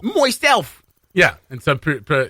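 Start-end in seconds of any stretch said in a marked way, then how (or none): 0.0-0.8 high, drawn-out Aussie "Moiself"; Australian pronunciation. Shown as a demonstration. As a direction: echo "Moiself" in a hammy Australian accent.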